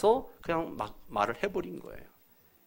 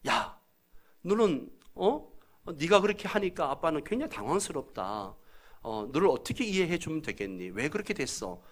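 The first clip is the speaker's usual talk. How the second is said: calm father, restrained and hesitant; 차분한 아이 메시지; 2 semitones higher, 3 dB quieter than usual. Acted. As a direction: talk gently to the child without shouting. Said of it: "아이 메시지는 어떻게 해요". (차분하게 타이르는 아빠 말투)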